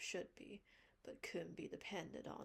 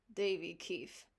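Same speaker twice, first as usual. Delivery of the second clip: stern scolding; 9 dB louder than usual. Acted. Marilla scolds Davy.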